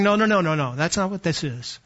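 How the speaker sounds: usual speaking voice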